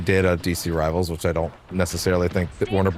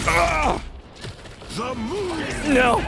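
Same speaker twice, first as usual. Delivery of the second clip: surprised yelp. Real, not acted. Sudden death in game.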